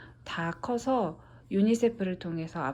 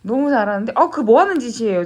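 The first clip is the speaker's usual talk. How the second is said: playful scolding; 3 semitones higher, 8 dB louder, faster than usual. Real, not acted. Mother scolds playfully on camera.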